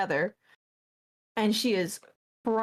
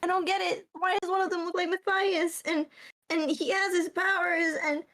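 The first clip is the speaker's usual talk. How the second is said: tearful; 9 semitones higher than usual. Acted. Leaf still crying, confused.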